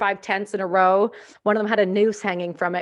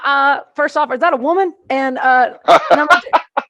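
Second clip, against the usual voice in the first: higher, louder hammed-up Southern voice, laughing; mock Texan reply; 5 semitones higher, 9 dB louder than usual. Texan on the radio asking if that's a woman, mock Southern. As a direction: play the confused Texan, laughing.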